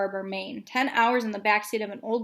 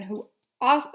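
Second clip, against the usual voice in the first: usual speaking voice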